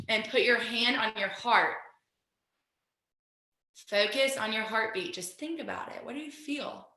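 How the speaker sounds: guided meditation. Acted. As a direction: guide them gently into stillness. Guides a mindfulness moment.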